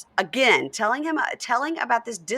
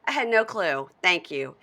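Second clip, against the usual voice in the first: sarcastic thanks; 5 semitones higher, 5 dB louder than usual. Real, not acted. Sarcastic thanks after quoting him.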